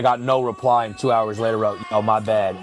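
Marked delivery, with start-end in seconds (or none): none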